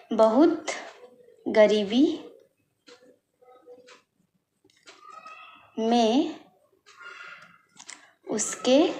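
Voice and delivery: word by word with long pauses; बहुत गरीबी में उसके दिन बीत रहे थे spoken one word at a time; slow dictation. Shown as a demonstration. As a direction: dictate each word slowly so pupils can write. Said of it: "हमें लिखना होगा इस तरह से".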